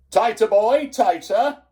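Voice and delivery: high, loud headmaster's command; snapped order. A narrator's reading. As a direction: snap it out sharply at a pupil. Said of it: "Mister Coombs snapped out".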